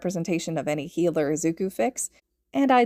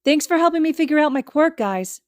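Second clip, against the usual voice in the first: high, louder call over the shoulder; calling back; 9 semitones higher, 6 dB louder than usual. Acted. Boy calling thanks to friends while being dragged away, loud and high. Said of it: "he calls back".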